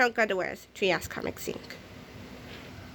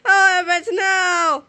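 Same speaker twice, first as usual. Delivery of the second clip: mock despair; 8 semitones higher, 4 dB louder, slower than usual. Villain begs.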